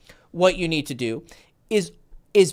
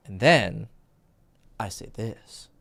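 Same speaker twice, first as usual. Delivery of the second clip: very low, hushed "I say this"; dramatic drop in pitch and volume on "I say this"; varied intonation; 9 semitones lower, 5 dB quieter, slower than usual. Shown as a demonstration. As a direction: pause after "then", sink to a soft "this". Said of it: "Use intonation".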